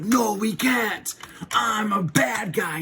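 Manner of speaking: mock anguished refusal. Tommy turning down the Rangers' friendship, hammed-up refusal. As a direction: draw it out like a tormented cartoon villain.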